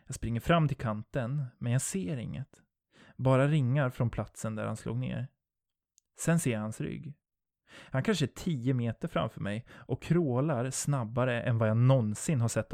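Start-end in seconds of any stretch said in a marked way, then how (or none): none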